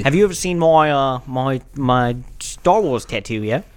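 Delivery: put-on Australian bloke voice; Jack Wallaby, Australian accent. Introducing his made-up character, Australian accent. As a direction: play a chummy Australian character. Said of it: "Australian guy".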